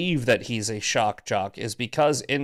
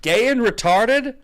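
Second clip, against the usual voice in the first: slow, higher and louder; deliberate emphasis; 8 semitones higher, 5 dB louder, slower than usual. Real, not acted. Driving a point home to John, slow and loud. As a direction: spell each word out slowly and loudly. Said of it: "I'm not sure who needs to hear this".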